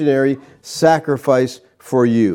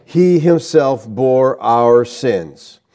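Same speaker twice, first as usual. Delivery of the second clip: slow scripture reading; much slower than usual. Shown as a demonstration. Preacher reading the verse to the congregation, slow and solemn. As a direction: read slowly, each word weighed. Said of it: "here is emphatic".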